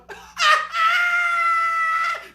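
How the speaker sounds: very high, loud squealing laugh; hammed-up hysterical laugh; shrieking cackle. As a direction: burst into a piercing comic cackle. Cackle in the put-on voice.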